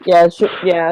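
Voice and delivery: ordinary talk, nothing marked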